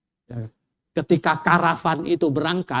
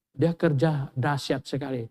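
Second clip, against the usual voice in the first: normal talking voice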